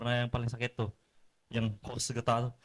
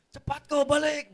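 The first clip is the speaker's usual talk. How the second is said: high, drawn-out call home; mock parental summons; impatient parent calling; 12 semitones higher, slower than usual. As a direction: stretch it out like a parent summoning a child. Parent calls child home, acted.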